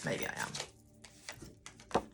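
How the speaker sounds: soft whisper to herself; whispered aside. Real, not acted. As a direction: breathe it as a private afterthought.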